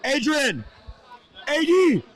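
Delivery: high loud call across the room; calling from far away. Real, not acted. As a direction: shout a name across the gym. Calling Adrian over across gym.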